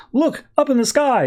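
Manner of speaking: high, excited crowd cry; mock awed onlooker; gawking crowd. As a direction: point up like an amazed bystander. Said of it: "people in the crowd are like".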